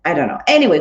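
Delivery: ordinary delivery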